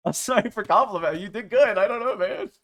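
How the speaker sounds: loud, high acted protest; high, loud mock-defensive apology; mock exasperated apology. Acted apology to angry friend.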